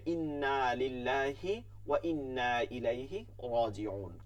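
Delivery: ইন্না লিল্লাহি slowly with long held vowels; beautiful careful recitation. Shown as a demonstration. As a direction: draw out ইন্না and রাজিউন with full articulation. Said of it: "সুন্দর করে পড়বো". (ধীরে সুন্দর করে টেনে পড়া)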